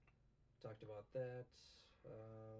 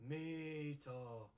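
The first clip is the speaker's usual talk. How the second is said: zombie Luffy quote; 4 semitones higher than usual. Retold or imitated. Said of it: "the last quote is from Zombie Luffy".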